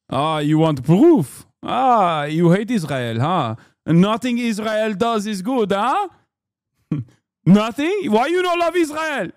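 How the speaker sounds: put-on taunting Israeli accent climbing to a high wail; mock Israeli accent. Israel defender taunting a critic over proof, in a mock Israeli accent. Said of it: "please stop with this accent".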